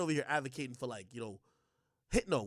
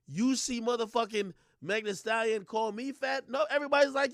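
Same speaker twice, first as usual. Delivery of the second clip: usual speaking voice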